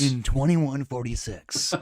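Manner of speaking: slow, low, dramatic date; movie trailer voice. Pretend. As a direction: announce the year like a trailer. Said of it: "you need to do it in your movie voice".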